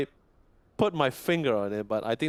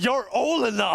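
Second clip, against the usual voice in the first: high, loud, hurt repeat of the phrase; wounded mock echo; 11 semitones higher, 5 dB louder than usual. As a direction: echo it back as if stung. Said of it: "And that hurts so much that you just brought up".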